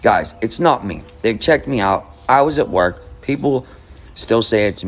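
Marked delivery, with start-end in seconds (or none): none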